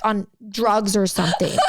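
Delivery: usual speaking voice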